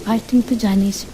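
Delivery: plain reading voice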